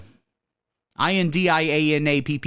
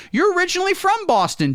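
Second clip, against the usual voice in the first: announcer call-out; 4 dB louder than usual. Acted.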